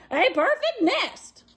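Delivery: high goose. Acted. Goose admires nest.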